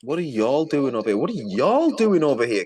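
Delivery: high, swooping mock question; mocking mimicry. Repeating her question back at Candy, loudly mocking. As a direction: imitate her challenge, swooping up on Y'ALL. Mimics the clip just played.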